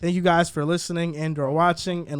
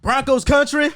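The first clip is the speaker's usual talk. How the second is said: country accent; 6 semitones higher, slower than usual. Shown as a demonstration. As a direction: lean into a light country twang. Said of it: "Why did you throw the accent on it".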